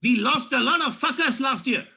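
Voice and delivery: hammed-up Pink Panther French; French accent. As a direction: ham up a French boss opening a meeting. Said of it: "how the French speak".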